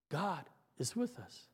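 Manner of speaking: put-on child whispering comfort to the next child; whispered. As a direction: breathe it like a child passing a secret. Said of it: "as the words are whispered".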